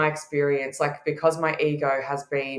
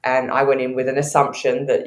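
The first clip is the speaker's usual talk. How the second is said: normal talking voice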